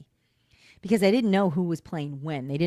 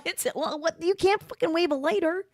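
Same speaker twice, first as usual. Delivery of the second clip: high, disbelieving protest; can't believe what she hears, voice jumps up; incredulous outburst; 11 semitones higher than usual. Real, not acted. Outraged at lighter app.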